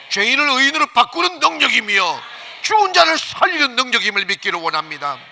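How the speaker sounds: loud, high, driving pulpit voice; ringing, insistent sermon climax; full-force preaching. Real, not acted. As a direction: proclaim it to the whole congregation.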